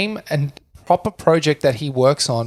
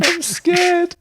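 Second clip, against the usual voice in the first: put-on much higher, slow little-boy voice; a boy puzzled by his changing body; scared kid. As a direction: fret like a frightened child. S1 child voice.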